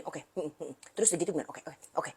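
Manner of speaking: muttering to oneself while noting tasks; quiet mumbling. Retold or imitated. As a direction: memorize under your breath. Listener quietly memorizing instructions.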